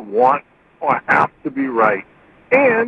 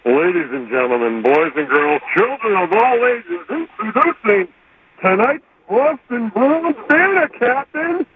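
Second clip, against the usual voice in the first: ringmaster; 4 semitones higher than usual. Pretend. Announcing like a circus ringmaster.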